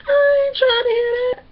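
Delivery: high, loud protest held on one pitch; put-on high-pitched objection; imitating Jay. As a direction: shout it high in mock refusal. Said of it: "this is what Jay say".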